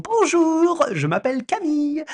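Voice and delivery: high, hammed-up French accent; comic mock French accent. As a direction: introduce yourself as a Frenchman. Impersonates French astronomer.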